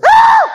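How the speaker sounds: sudden piercing high shriek; very loud, high imitated child's scream; hurt kid's scream. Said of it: "that same kid goes".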